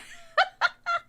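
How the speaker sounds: squeaky burst of laughter; high giggling cackle. Real, not acted. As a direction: let a high cackle escape. Laughing after toad song.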